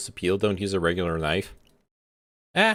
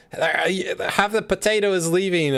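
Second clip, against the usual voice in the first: higher, teasing nagging mom; 9 semitones higher, slower than usual. Retold or imitated. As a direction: nag about the bad potato peeling. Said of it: "They're, they'll be like".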